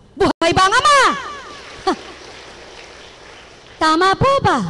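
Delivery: fiery preacher call-and-response; preaching at full force. Acted. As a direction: rouse the congregation with ringing questions. Preacher calls out.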